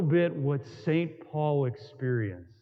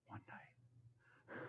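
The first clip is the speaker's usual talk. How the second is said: breathy whispered echo of one night; hushed whisper to himself; 34 dB quieter than usual. Acted. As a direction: drop to a bare whisper, as if to himself. Whispered echo of his prayer.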